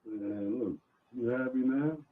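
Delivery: ordinary delivery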